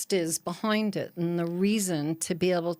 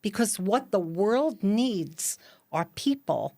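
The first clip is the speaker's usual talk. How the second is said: slow quote reading; slower than usual. Acted.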